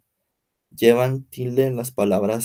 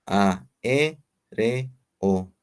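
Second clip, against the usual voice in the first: AÉREO slowly split as A, E, RE, O; slow teacher's syllable breakdown; slower than usual. Shown as a demonstration. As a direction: separate each syllable with a clear pause. Same word split into syllables.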